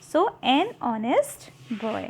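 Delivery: honest said very slowly as "onest", no h; slow model with the h dropped; teacher modelling an honest boy slowly; silent h demo. Shown as a demonstration. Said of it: "ये जो एच है वो silent है".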